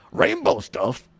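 mock disbelief, pitched low; incredulous. Real, not acted. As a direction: repeat it in disbelief. Incredulous repeat, low, far.

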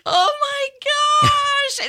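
imitating Joe. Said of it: "And he's like".

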